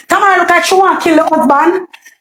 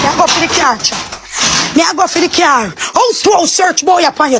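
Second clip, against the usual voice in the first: normal talking voice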